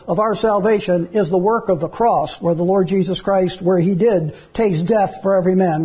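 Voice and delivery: usual speaking voice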